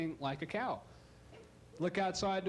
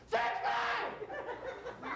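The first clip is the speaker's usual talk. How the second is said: much higher shrieking shout of "French fry!"; screamed order into the mic. Acted. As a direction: bellow "French fry!" into a microphone a foot from the cook. Counter girl yells into mic.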